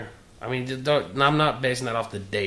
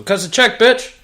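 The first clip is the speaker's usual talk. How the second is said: shouted, higher, hyped up; hype shout; 13 semitones higher, 15 dB louder than usual. Pretend. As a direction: yell it like a hype man. Hyped demand to listeners.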